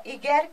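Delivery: Y said as French "i-grec"; French letter name. Shown as a demonstration. Teacher naming the extra French vowel, Y as "i-grec". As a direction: say Y the French way, "ee-grek".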